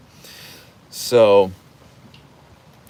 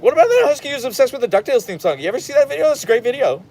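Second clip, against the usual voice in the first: much higher, fast, louder gushing questions; overeager rapid fire; 14 semitones higher, 4 dB louder, faster than usual. Retold or imitated. Imagined reply to a husky fan, fast, high and overexcited. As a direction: bombard the stranger with eager questions. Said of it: "I wanna be like".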